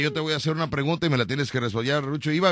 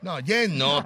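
normal talking voice

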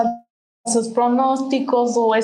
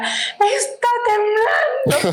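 high, drawn-out mock shout; retold yelling; 10 semitones higher, much slower than usual. Dad yelling to mom watching her soap opera, mock shout. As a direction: imitate a panicked yell, stretched and high. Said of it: "o sea, gritándole".

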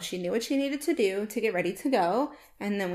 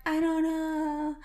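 imitating daughter; 7 semitones higher, slower than usual. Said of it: "She's like".

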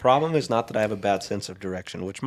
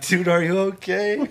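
high, slow, alarmed call over the phone; startled friend calling out, are you okay; worried friend; 10 semitones higher, 5 dB louder, slower than usual. Retold or imitated. Friend calling after loud fall.

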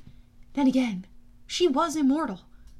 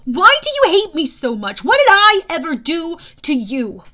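high, loud, indignant protest; hurt, angry raised voice; frustrated outburst; 6 semitones higher, 12 dB louder than usual. Acted. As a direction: demand answers from a bully. Hero protests at hostile god.

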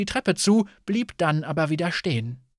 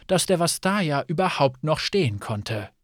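plain reading voice

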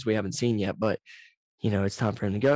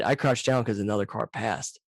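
ordinary talk, nothing marked

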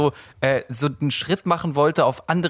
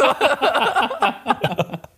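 helpless laugh; 10 semitones higher than usual. Real, not acted. Lacht über eigene Aktion.

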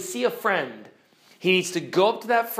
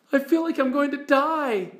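put-on higher, small pleading girl's voice; timid girl Jill; 9 semitones higher than usual. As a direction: ask the lion shyly.

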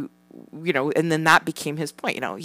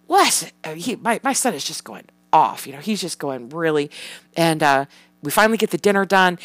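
normal talking voice

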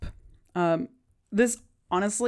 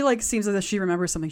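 ordinary delivery

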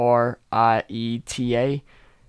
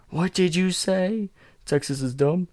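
mock indignation; 8 semitones higher than usual. Mock offence for Texas.

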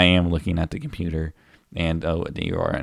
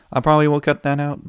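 "probably" sung out much higher and louder; mock high voice; 9 semitones higher, 5 dB louder, faster than usual. Repeating his promise to cut the burp, high and loud. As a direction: repeat the line in a high teasing voice.

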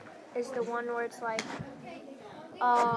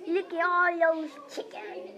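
higher, drawn-out meme imitation; meme quote; 5 semitones higher, slower than usual. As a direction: stretch out the catchphrase in a raised voice. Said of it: "the one where it's like".

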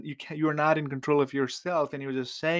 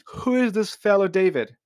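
higher, louder sneer; 7 semitones higher, 5 dB louder than usual. A narrator's reading. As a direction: sneer down at the young man. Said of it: "Nabal sneered to the young man".

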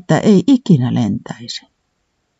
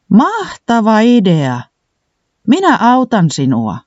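higher, slow, eager exclaim; excited exclaim; 8 semitones higher, slower than usual. A narrator's reading. Puuhis eagerly offering to help Tiputi, excited exclaim. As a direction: cheer the idea on, drawn out and bright in pitch. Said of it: "huudahti Puuhis".

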